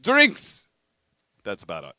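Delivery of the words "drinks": put-on loud, hammy Russian accent. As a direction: play a boisterous Russian, high and loud. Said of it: "I was gonna try my Russian accent".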